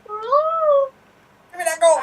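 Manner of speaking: high, slow screeching bird voice; pterodactyl screech. Pretend. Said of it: "as if you are, um, pterodactyls".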